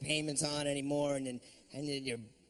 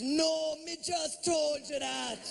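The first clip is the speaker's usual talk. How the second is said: much higher, exasperated Jamaican guide; a Jamaican guide losing patience; 9 semitones higher, slower than usual. Retold or imitated. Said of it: "he goes".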